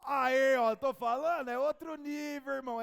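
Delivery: loud slow preacher's emphasis; loud exclaim. Real, not acted. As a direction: hammer it home to the congregation.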